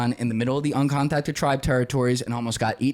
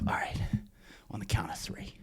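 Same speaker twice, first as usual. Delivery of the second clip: whispered, much softer; whispered countdown; 6 semitones lower, 10 dB quieter than usual. Retold or imitated. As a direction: whisper the count so nobody hears. Hiding quietly from ants.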